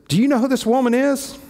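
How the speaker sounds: high, outraged demand; indignant outburst. Retold or imitated. Pharisee scandalised at the woman at Jesus' feet, high indignant outburst. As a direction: throw the question up high in scandalised disbelief. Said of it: "The Pharisee goes nuts".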